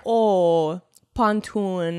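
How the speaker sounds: Minnesota accent with the O in "oh" and "pontoon" exaggerated. Shown as a demonstration. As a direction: lean on the O's of "oh" and "pontoon" like a Minnesotan. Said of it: "focused on the O's".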